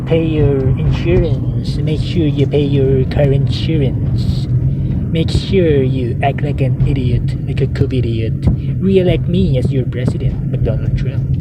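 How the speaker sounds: mocking impression of a president giving orders; McDonald Trump. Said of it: "that's the way he speaks".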